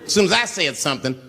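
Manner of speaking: ordinary talk, nothing marked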